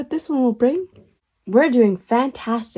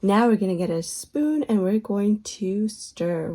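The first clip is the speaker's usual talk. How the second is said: ordinary talk, nothing marked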